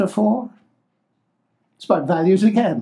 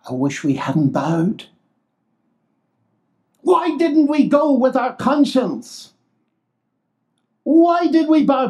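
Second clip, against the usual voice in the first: regretful cry; 6 semitones higher than usual. Retold or imitated. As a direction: cry out the regret of the ones who bowed. Regretful crowd voiced in sermon.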